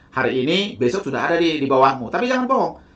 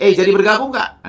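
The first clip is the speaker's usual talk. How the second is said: loud, higher, impatient question; pushy, loud sales question; blunt pushy ask; 6 semitones higher, 6 dB louder than usual. Shown as a demonstration. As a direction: demand an answer from the prospect. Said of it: "jangan bilang begini".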